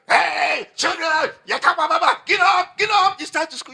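put-on mother shouting the children up for school; mock yelling mother. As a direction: yell the kids out of bed. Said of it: "You don't come".

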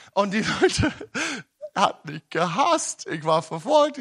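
normal talking voice

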